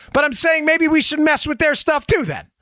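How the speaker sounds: ordinary talk, nothing marked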